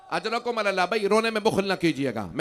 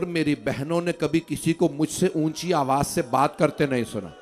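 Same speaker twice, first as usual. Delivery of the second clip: ordinary delivery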